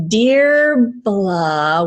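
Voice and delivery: name sounded out in an American accent. Shown as a demonstration. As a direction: read the Irish name as an American would. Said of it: "say it with an American accent".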